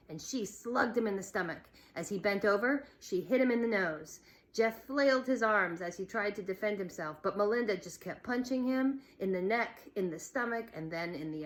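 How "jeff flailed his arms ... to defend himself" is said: ordinary delivery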